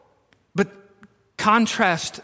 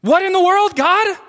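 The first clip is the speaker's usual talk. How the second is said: high, loud, incredulous outcry; exasperated accusing; 10 semitones higher, 4 dB louder than usual. Retold or imitated. Moses protesting to God, high and exasperated. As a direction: hurl the question at God in disbelief. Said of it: "he's accusing God".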